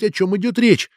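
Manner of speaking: usual speaking voice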